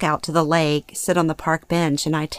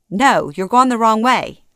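higher, louder objection; lightly voiced insistent mother; 5 semitones higher, 5 dB louder than usual. A narrator's reading. Mother correcting daughter on the drive home, mildly agitated.

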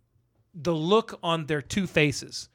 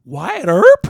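much louder startled question; stunned realization; 3 semitones higher, 11 dB louder than usual. Retold or imitated. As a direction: gasp out the name in disbelief. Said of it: "And he goes".

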